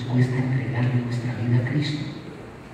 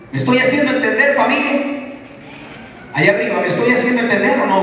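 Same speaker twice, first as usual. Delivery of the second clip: high, loud and fast call to the crowd; rallying preaching; 12 semitones higher, 5 dB louder than usual. Acted. Pastor checking his congregation follows, in loud fast preaching. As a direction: fire up the people up in the balcony.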